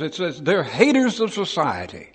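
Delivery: normal talking voice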